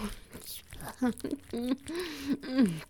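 whispered laugh. Acted. Lover laughs under breath at partner, whispered. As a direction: let a breathy laugh trail off.

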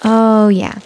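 ordinary talk, nothing marked

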